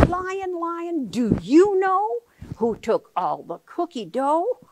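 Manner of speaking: mama puppet asking Lion slowly; Mama's voice. Acted. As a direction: ask Lion like a worried mama. Mama asks Lion in book.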